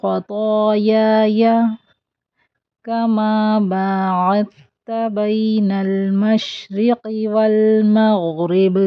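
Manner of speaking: slow melodic Arabic recitation; slow chanted dua; Quran-style recitation. Shown as a demonstration. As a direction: recite slowly with tajweed. Recites full line.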